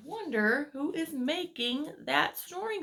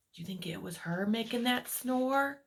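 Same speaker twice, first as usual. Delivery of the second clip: usual speaking voice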